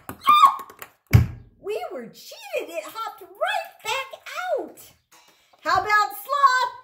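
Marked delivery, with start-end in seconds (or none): 0.1-1.3 sudden high yelp of dismay; surprised yelp. Real, not acted. Yelp when the ball bounces back out.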